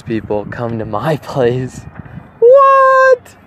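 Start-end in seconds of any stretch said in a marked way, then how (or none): 2.4-3.4 a shrill, loud, much higher What?; surprised. Real, not acted. Realizing college dancers could come, loud surprised What?. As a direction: shriek the word in sudden surprise.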